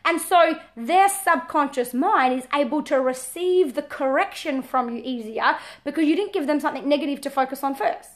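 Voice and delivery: usual speaking voice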